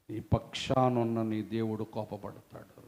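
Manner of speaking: ordinary delivery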